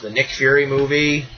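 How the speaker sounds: ordinary talk, nothing marked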